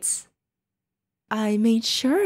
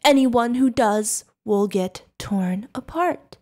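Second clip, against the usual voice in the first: ordinary delivery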